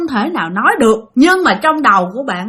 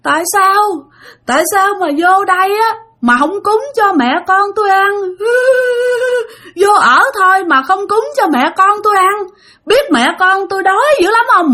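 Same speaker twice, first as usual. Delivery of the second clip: crying ghost; 9 semitones higher than usual. Retold or imitated. Ghost mother reproaching the sleeper for no offerings, wailing high. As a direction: wail it as a hungry, weeping spirit. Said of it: "Mà vừa khóc mà vừa nói như vậy".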